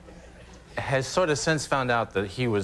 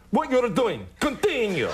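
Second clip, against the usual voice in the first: put-on high, flat Russian-accented command; deadpan Russian accent, businesslike; a Russian girlfriend in bed, high mock Russian accent; 11 semitones higher than usual. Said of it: "It's more like".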